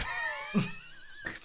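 sudden high shriek of laughter; burst of laughter. Real, not acted. Co-host laughing at his own stalled line. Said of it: "Was that?".

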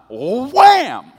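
very high, loud wham with a laugh; big wham. Retold or imitated. Husband reenacting his pillow swing, loud high wham. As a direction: swing it hard. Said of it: "I just went".

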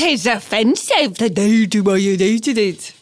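exaggerated, garbled Irish mimicry; hammed-up Irish imitation; mock Irish accent. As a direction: parrot them in a bad Irish accent. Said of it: "assessment of my Irish accent".

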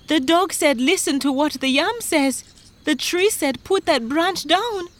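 high, urgent recital; worked-up high-pitched account; frightened farmer. Acted. Frightened farmer retells his troubles.

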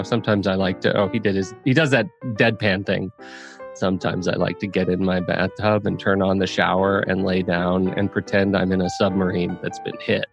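usual speaking voice

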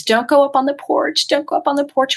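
imitating a frightened girl begging friends; upset begging. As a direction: plead like an upset teenage girl. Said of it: "very upset and begged her friends".

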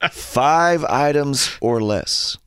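very slow, drawn-out sign reading. Acted. Shopper reads the lane sign aloud, slow and deliberate. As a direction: stretch each word of the posted rule. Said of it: "and it says".

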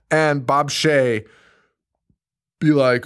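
ordinary talk, nothing marked

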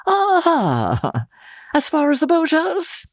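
high, sly Aha with rising boathouse; mock surprise. Count echoing boathouse.

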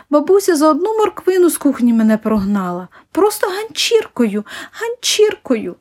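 put-on high-pitched, indignant child's grievance; high, hurt complaint, dragged out at the end; aggrieved child complaining. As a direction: complain like a wronged little boy, pitch climbing. Boy complains of being chased out.

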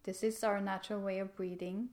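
ordinary talk

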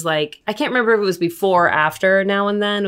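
usual speaking voice

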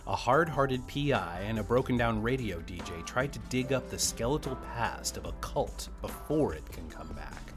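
normal talking voice

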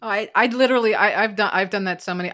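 ordinary talk, nothing marked

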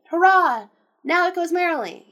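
loud, high hurrah easing into glee; merry cheer. A narrator's reading. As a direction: cheer it out merrily. Character cheers as the game begins.

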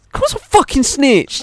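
high, loud, indignant burst; mock outrage. Told he snitched, he fires back high and loud. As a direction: squeal it out defiantly.

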